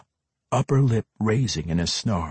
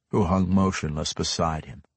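ordinary delivery

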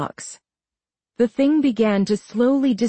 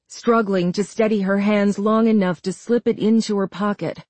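plain reading voice

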